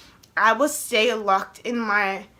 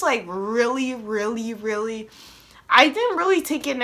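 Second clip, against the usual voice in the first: ordinary delivery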